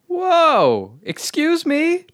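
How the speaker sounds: high, loud offended whoa; shocked outrage. Acted. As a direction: recoil in disbelief. Guest shocked by insult.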